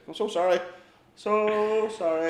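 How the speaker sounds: high, drawn-out apology; sheepish mock apology. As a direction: imitate creeping out with a high, stretched sorry. Retold backing out of tent, apologising.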